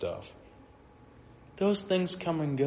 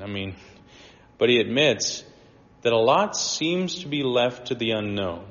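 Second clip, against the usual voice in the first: ordinary delivery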